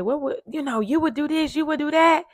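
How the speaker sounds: lightly mock what people always claim, pitch climbing; people second-guessing. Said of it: "people always say".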